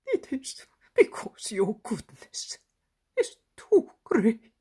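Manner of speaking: put-on old man choking up as he speaks; guttural, tearful. A narrator's reading. Old man thanking Jesus, guttural and tearful. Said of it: "in his guttural voice".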